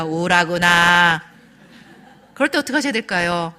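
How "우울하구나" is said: loud, slow robotic delivery; mechanically drawn-out mock empathy. Husband parroting wife's requested line, like a soulless AI. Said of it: "에이아이처럼 이럴 거예요".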